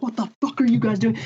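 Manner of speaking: high, loud mock yell at the band; angry roadie yelling. Roadie yelling at the band onstage, mock angry shout. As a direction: bark it like a furious roadie throwing you off. Road crew dragging band offstage.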